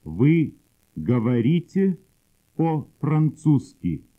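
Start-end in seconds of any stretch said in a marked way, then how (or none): none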